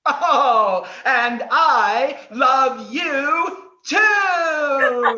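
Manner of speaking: drawn-out tender reply. Acted. Host answers lovingly.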